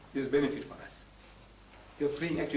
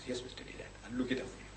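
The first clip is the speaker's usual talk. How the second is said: breathy near-whisper, much softer; hushed reply; 6 semitones lower, 12 dB quieter, faster than usual. Retold or imitated. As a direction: mimic a meek, barely voiced answer. Quoting Mr Naidu's meek reply.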